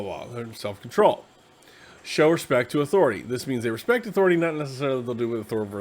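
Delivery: ordinary talk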